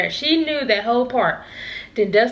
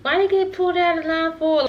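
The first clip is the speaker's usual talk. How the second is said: high-pitched mocking complaint; mock annoyed girl; 8 semitones higher than usual. Girl left in line complains about others, mocked high. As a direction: imitate a girl getting mad, pitched up. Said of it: "She was getting mad".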